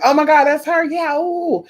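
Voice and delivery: high-pitched retold squeal of recognition; gushing, high-voiced fan reaction; excited friend. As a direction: imitate a thrilled friend, voice jumping up. Friend's thrilled reaction on phone.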